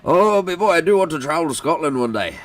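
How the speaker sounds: bad Scottish accent. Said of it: "Oh, no, that was bad".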